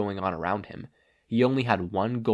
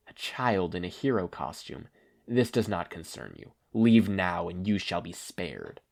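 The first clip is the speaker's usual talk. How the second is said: menacing villain; 3 semitones lower than usual. Acted. Stain warning the boy off, low and cold.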